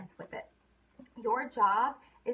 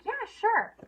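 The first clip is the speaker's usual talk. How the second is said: a much higher, louder sure; eager yes; 12 semitones higher, 6 dB louder than usual. Retold or imitated. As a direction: play the keen customer agreeing. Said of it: "And they're like".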